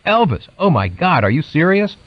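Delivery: high, thrilled squeal of disbelief; excited aunt. Retold or imitated. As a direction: gush like a starstruck fan. Aunt thrilled at Elvis news.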